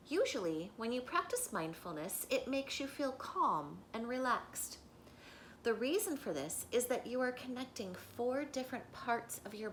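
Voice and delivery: measured, emphatic picture-book reading; reading aloud to children. Acted. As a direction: read the book page out clearly to a class. Reads the book page aloud.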